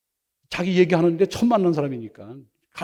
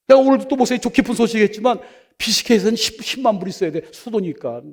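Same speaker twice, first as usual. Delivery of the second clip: ordinary talk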